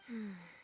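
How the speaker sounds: long low sigh; deep tired sigh; resigned sigh. Acted. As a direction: let the breath out in resignation.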